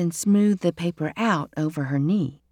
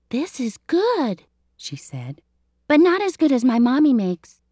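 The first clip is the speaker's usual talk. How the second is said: put-on a small girl judging cookies; little girl; 6 semitones higher than usual. Elizabeth, a child, speaks.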